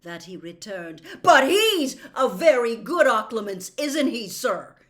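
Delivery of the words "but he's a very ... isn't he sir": loud, higher, straining to stay calm; shaking, held steady. Acted. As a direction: force it steady through anger. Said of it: "voice was shaking with the effort of keeping it steady".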